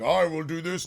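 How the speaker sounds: mock-epic resolve; Satan. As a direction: declare it like a defiant fallen angel. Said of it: "And he's just like".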